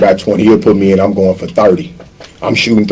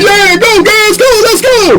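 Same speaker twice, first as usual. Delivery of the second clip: high, hammed-up cheer; mock bench cheering. Benched player fakes cheering teammates, high and hammed-up. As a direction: cheer them on, fake enthusiasm.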